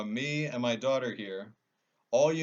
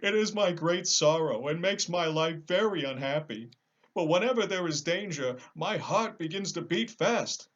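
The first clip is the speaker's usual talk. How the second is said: sorrowful lion; 7 semitones higher, 5 dB louder than usual. Acted. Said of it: "wiping a tear from his eye".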